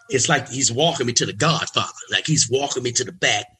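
normal talking voice

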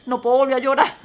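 loud burst of laughter over the words; laughing. Real, not acted. Alone in the office after the crisis, laughing loudly. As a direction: laugh it off before the tears come back.

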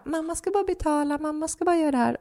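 high, quick sing-song mother voice; talking to a child. Retold or imitated. Mother at the checkout to her little son, high sing-song. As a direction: sweet-talk a toddler while paying, pitched high. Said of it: "som man pratar till sitt barn".

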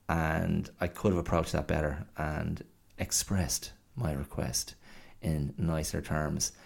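normal talking voice

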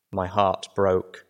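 usual speaking voice

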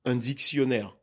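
un dictionnaire in French, syllables even; French pronunciation. Shown as a demonstration. Guest gives host the example, French un dictionnaire. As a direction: say un dictionnaire as a native French speaker, "deek-syon-air". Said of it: "In French, we say".

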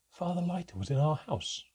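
plain reading voice

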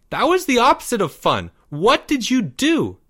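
put-on high kid voice; accuse, pitched well up; indignant young boy. A narrator's reading. Boy confronting girl at her door, high and indignant.

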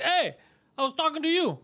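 high, insistent mock shout; retold stranger's pushy call; imitated yelling. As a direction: demand attention from someone ignoring you. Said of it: "'cause he was yelling".

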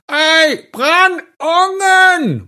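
very drawn-out high yell; desperat råb. A narrator's reading. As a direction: holler it slowly, tongue not working. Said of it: "prøver far igen". (langtrukket, desperat skrig)

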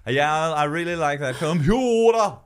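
high, loud, exaggerated Danish accent; mental Danish accent. Dane revealing Danishness, mental Danish accent. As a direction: go crazy in Danish on "computer". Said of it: "Their mouths would go mental".

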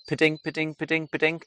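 high, even repeated bird call; sing-song two-note bird mimicry; great tit call imitation. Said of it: "So did you hear that".

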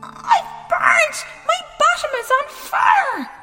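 very high, loud cries of pain; scalded shrieking. Acted. King sitting in hot porridge, shrieking. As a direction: shriek it like a burnt little king.

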